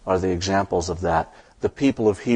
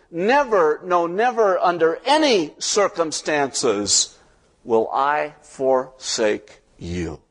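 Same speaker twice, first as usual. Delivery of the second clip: shouted promise, fading; 7 semitones higher, slower than usual. Acted. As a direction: shout it, then let it settle slowly. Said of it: "God shouts out the promise".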